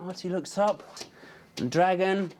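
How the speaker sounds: ordinary delivery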